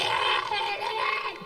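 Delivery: high imitated shriek of panic; mock scream. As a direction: squeal it like the startled girlfriend. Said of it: "then she started screaming".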